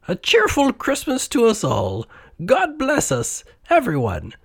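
put-on high-pitched small child's voice; tiny little boy. A narrator's reading. Cratchit's little son blesses the family at Christmas dinner, high child voice. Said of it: "said Cratchit's tiny little son".

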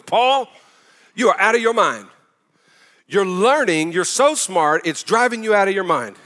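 high, loud mock outburst as an accusing governor. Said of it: "says in a loud voice".

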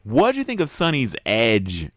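ordinary delivery

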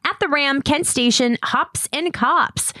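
announcer read. Acted. Announcer promo, slow emphatic.

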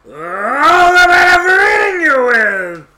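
huge, high, drawn-out roar; roaring wolf. A narrator's reading. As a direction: roar it at full volume. Wolf pounces on the girl.

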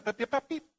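high-pitched spoken beeps; imitated warning beep. Shown as a demonstration. Preacher stopping someone without the microphone, quick high beeps. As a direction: beep like an alarm to halt someone. Imitates alarm to halt speaker.

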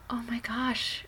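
breathless whisper of a nervous mom. Retold or imitated. Mom watching her child drive off, whispering. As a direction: hush it out as if watching anxiously. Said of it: "But I was just like".

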